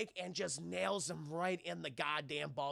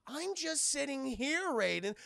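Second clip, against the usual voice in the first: mock innocent; 7 semitones higher than usual. Shang Tsung denying it to Raiden, mock innocent. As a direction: act like butter wouldn't melt. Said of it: "He goes".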